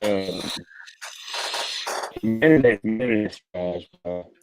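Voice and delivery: choppy bad connection audio; cutting out over a bad line; breaking up. Real, not acted. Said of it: "You're breaking up a bit".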